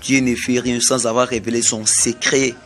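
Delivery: normal talking voice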